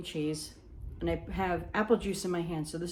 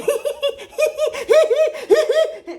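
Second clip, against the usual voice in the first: high, loud burst of laughter; 11 dB louder than usual. Retold or imitated. Maid of honor laughing at her speech blunder, high and loud. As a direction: let out a big embarrassed laugh. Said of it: "So I'm laughing like".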